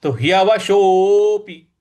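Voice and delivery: ह्यवशोऽपि with the ओ drawn long and loud; long o demo. Shown as a demonstration. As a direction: stretch the ओ in ह्यवशोऽपि. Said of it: "तो इसको दीर्घ खींचना है".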